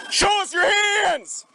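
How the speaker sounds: slow, drawn-out shouted command; shouted surrender demands. Real, not acted. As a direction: yell each word at the driver. Officer orders suspect out.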